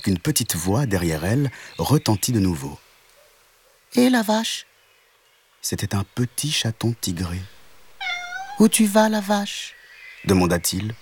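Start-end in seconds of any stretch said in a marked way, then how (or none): 3.9-4.7 much higher call; a small tabby kitten hailing; little kitten calling. Acted. Kitten calls the cow. 8.6-9.7 much higher, curious; a kitten asking the cow; little kitten. Acted.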